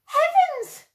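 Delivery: high, loud startled yelp; startled cry. Acted. Startled man crying out at the owl. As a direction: jump with fright at a sudden bump. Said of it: "cried the man".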